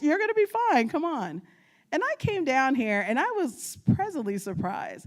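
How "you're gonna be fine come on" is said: reassuring. Retold or imitated. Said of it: "she's like".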